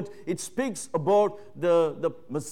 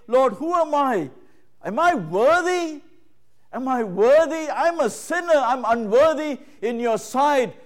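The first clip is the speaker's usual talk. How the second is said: Mary's humble plea; 6 semitones higher, 5 dB louder than usual. Retold or imitated. Preacher voicing Mary's plea.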